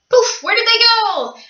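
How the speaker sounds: high, playful fake wonder; mock surprise. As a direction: pretend they vanished like magic. Crew vanishes, playful magic trick.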